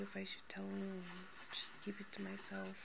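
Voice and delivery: hushed worried whisper. Real, not acted. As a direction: whisper it like a secret. Asking advice, unvoiced whisper.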